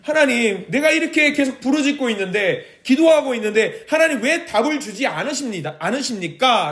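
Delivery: high, urgent cry to God; pleading protest. Acted. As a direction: plead and protest why no answer comes. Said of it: "하박국 선지자는 하나님께 항변하고".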